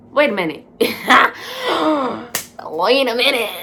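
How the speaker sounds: loud, laughing disbelief; retold shock broken by laughter; incredulous. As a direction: halt everything, stunned and laughing. Laughing disbelief at news of dying.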